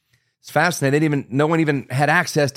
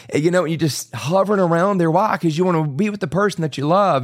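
ordinary talk, nothing marked

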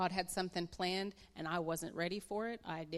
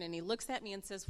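usual speaking voice

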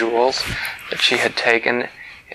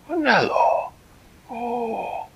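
higher, eager cat-king voice; a delighted, fussy creature greeting a friend; Flibbertigibbet's voice; 12 semitones higher than usual. Acted. Storyteller voicing Flibbertigibbet greeting Winella, high and delighted.

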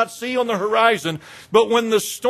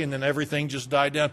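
ordinary delivery

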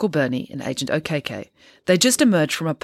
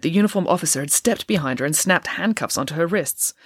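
ordinary delivery

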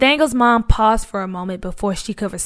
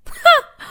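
mocking laugh; 5 dB louder than usual. Bully mocking the boy's refusal.

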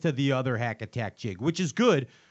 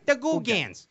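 Chicago accent; 8 semitones higher than usual. Shown as a demonstration. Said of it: "in a Chicago accent".

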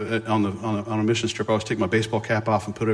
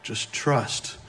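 hushed, near-whispered reassurance; soft, spaced-out words, barely voiced; quiet voice; 8 dB quieter, much slower than usual. Quiet voice answering the raging one, hushed. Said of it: "Quiet voice".

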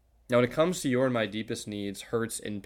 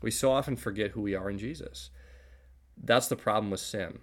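normal talking voice

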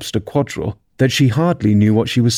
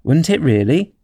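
higher-pitched astonished question; great surprise; 5 semitones higher than usual. A narrator's reading. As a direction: ask it in polite amazement. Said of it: "in a tone of great surprise".

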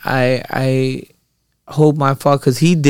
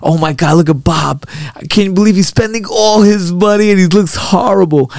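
mock shocked fan; 5 semitones higher than usual. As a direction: ham up a fan gasping at Bob. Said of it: "they were like".

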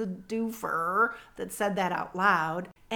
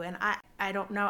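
usual speaking voice

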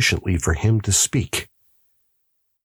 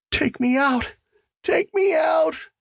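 high-pitched frantic scream, held back in volume; screamed plea. Acted. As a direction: cry out high, desperate to be let out. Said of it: "he screamed".

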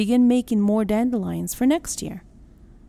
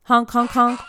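honking goose from afar, louder; goose honks; 3 semitones higher, 5 dB louder than usual. Acted. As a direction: answer the flock with honks. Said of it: "cried the wild goose".

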